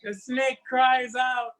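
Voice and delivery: lively, sing-song folktale telling; storyteller spinning a West African folktale; story narration. A narrator's reading. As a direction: tell it to a circle of children.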